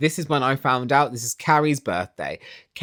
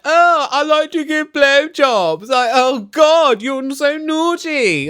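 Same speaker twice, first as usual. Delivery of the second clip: high, slow, sultry mock voice; mocking housewives; 10 semitones higher, 4 dB louder, slower than usual. Vlogger mocking housewives' sex talk, high slow mock-sultry. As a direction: lampoon them with a put-on flirty voice. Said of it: "we're all like".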